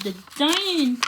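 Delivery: plain reading voice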